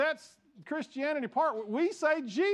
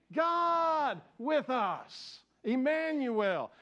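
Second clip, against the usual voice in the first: slow call and response; 3 semitones higher, much slower than usual. Shown as a demonstration. Pastor repeating the response for his church, very slow and drawn out. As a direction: stretch God with us out very slowly, high and strong. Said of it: "Say it with me".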